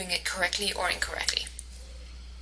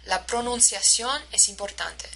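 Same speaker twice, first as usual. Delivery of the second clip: same beat on every syllable of "La pronunciación es importante"; "La pronunciación es importante" in even Spanish syllables; syllable-timed Spanish rhythm; 2 semitones higher than usual. Shown as a demonstration. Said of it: "the same beat on every syllable".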